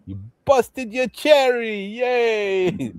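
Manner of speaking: high, slow mock cheer; high, slow hammed-up mobster cheer; mock celebration. Quoting mob celebration.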